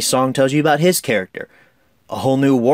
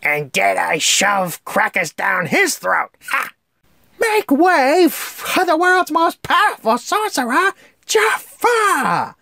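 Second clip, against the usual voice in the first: high, slow, brash parrot announcing; Iago; 14 semitones higher, slower than usual. Retold or imitated. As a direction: announce it like a gloating cartoon parrot. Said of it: "He's got great lines throughout it".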